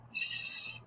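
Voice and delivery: S as a long sustained sss; letter sound. Shown as a demonstration. Teacher showing the sound of C with E, S as sss. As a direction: hold the S as a pure hissed sound, no letter name. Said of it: "that sound is going to be".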